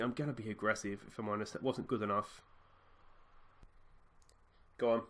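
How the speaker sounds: ordinary talk, nothing marked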